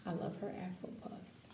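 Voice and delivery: soft unvoiced aside; whisper. Real, not acted. Teacher murmuring to the kids about a picture, whispered. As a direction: breathe it out as a private aside. Aside while showing the page.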